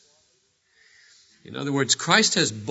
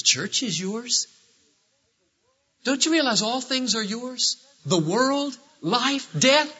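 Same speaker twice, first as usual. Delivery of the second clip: mock rebuke; 11 semitones higher than usual. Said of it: "trying to paraphrase Paul, and I said".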